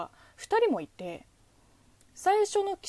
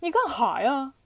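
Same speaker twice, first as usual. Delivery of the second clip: "ニカンハや？" in punchy Dongbei dialect, a bit louder and rising; Northeastern Chinese dialect; 4 semitones higher, 5 dB louder than usual. Shown as a demonstration. Showing viewers the comic Dongbei version, punchy Dongbei dialect "ニカンハや？". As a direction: throw "ニカンハや？" out like a Dongbei comedian. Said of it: "東北話だと".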